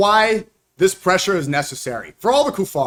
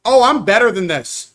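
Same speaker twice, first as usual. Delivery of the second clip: mocking smug voice; 4 semitones higher, 5 dB louder than usual. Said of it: "he's gonna try the thing".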